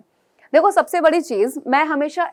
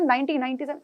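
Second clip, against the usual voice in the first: quiet student boast; 8 dB quieter, faster than usual. Retold or imitated. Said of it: "ऐसे बोलते हैं".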